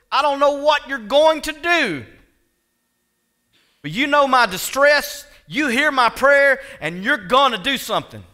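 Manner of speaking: loud, high, impassioned prayer; full-voiced pulpit plea; crying out to God. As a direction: preach it as a desperate, trusting cry. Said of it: "cried out to the Lord".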